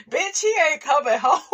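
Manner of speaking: high laughing jeer, cracking up; laughing jab. Acted. Laughing high at the woman cooking for a jailed man. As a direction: laugh it out high while mocking her. Mocking laugh at jail wife.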